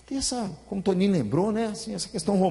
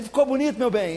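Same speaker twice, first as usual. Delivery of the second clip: higher, mock wife asking for praise; 5 semitones higher than usual. Wife showing off her haircut, mock higher voice. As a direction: chirp it like the wife fishing for a compliment. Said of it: "fala assim".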